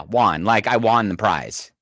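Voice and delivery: WON with an open AH vowel, "wahn"; won like Juan. Shown as a demonstration. Host explaining her hometown word, WON said like Juan. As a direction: make WON rhyme with Juan. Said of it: "Which sounds like J U A N".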